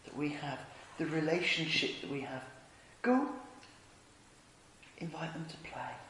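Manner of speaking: hushed, low, gentle sending; tender Father. Acted. Father sending Jesus to invite the world, hushed and tender. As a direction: speak softly and low, leaving space.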